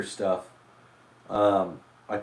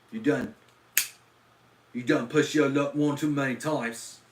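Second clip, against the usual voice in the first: put-on loud, high, over-the-top Cockney accent; 6 semitones higher, 7 dB louder than usual. Threatening a viewer in an exaggerated Cockney accent. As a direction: bark it out like a hammed-up London street tough. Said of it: "the Lower East Side would be like".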